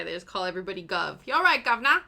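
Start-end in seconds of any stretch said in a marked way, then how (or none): 1.3-2.0 loud, higher hammed-up British greeting; mock British accent. Showing what British people supposedly say, mock British accent. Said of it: "that's just what British people say".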